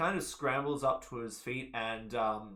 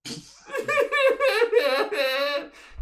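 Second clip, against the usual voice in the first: high wailing cry; loud wailing; 10 dB louder than usual. Acted. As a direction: wail out loud. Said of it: "He cries louder".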